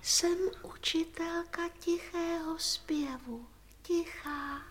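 hushed half-sung introduction; very quiet. Acted. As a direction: introduce yourself softly, as if singing under your breath. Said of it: "Neumí nahlas, je tichá". (tiše, zpěvavě, skoro neslyšně)

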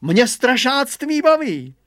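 high, loud, swaggering boast; puffed-up boaster. A narrator's reading. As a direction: brag like a proud show-off. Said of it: "naparoval se Hugo".

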